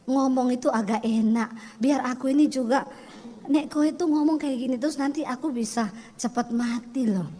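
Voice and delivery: lightly voiced Solo husband pleading; soft Javanese husband. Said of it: "lalu dia bilang gini".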